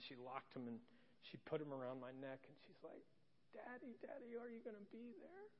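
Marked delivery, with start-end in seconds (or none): 3.5-5.5 put-on high, soft little-girl question; small pleading voice, rising at the end; anxious child. Toddler clinging to dad's neck, imitated anxious question.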